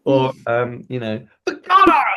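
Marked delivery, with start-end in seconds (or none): 1.5-2.0 high, loud outburst; angry. Shown as a demonstration. Showing an angry echo in a drama exercise, angry. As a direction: cry it out in hammed-up exasperation. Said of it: "It could be angry".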